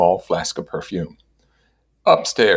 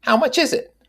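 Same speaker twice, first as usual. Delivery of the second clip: very high, gushing over the puppy; enthusiastic. A narrator's reading. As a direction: squeal it out with delight. Mrs. Wilson voiced.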